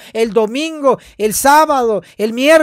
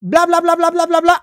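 mocking chatter; 5 semitones higher than usual. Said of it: "Solo con".

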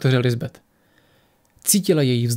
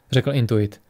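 ordinary delivery

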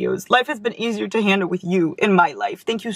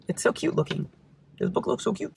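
soft whispered aside; 3 semitones lower, 8 dB quieter than usual. Real, not acted. Whispering to herself over a cute book cover. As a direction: breathe it to yourself in a whisper.

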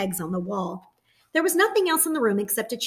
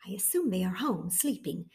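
hushed, softer reply; whispered; 8 dB quieter than usual. A narrator's reading. Mama answering the soldier in a whisper. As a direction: whisper it to spare the sleeping children.

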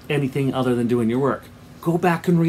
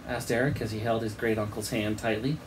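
plain reading voice

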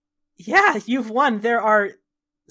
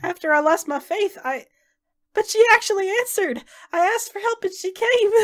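much higher, cracking, crying through the words; tearful joy; 9 semitones higher than usual. Acted. Salvador tells Nyla his goddess answered, crying with joy. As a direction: sob out the relief. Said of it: "He's ugly crying again".

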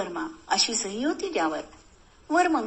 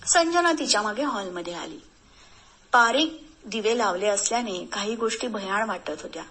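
plain reading voice

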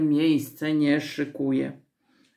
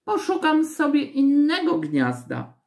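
high-pitched huffy refusal; acted high, miffed crow voice; offended young crow; 11 semitones higher than usual. A narrator's reading. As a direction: refuse like a sulky little bird. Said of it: "bardzo obrażona".

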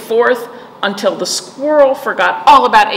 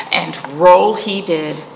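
plain reading voice

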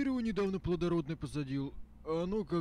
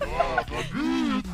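mocking; 9 semitones higher, 8 dB louder, much slower than usual. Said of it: "laughs, then mocks".

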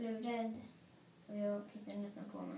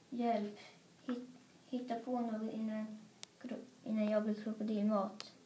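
ordinary delivery

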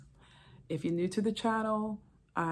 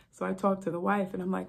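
ordinary talk, nothing marked

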